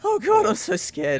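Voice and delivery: a panicked outburst; frightened woman. Acted. Game master as frightened woman.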